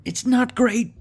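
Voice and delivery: high, squeaky put-on voice; high mock voice. Gamer judging his ragtag army in a squeaky voice. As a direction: squeak the verdict comically.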